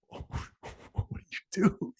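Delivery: slow whisper; whispered aside. Real, not acted. Seated speaker unsure amid standing ovation, slow whisper. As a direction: breathe it slowly, lost in the crowd.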